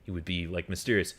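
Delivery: usual speaking voice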